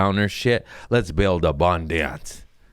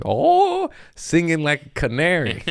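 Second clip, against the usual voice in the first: laughing self-mockery; 9 semitones higher than usual. Real, not acted. Mocking his own chatter.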